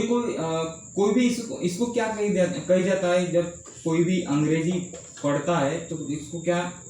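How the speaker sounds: ordinary talk, nothing marked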